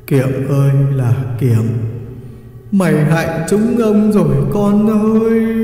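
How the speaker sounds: slow, drawn-out ghostly reproach; stretched-out mournful cry; wailing lament. A narrator's reading. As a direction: lament to your son, rising into a wail. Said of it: "Vong còn lại cũng khóc lóc".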